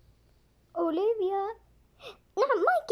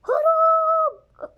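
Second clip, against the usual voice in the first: drawn-out loud yell of escape; long shout; 8 semitones higher, 10 dB louder, much slower than usual. Acted. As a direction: stretch it into a big shout. Children shouting escape game. (صرخة طويلة)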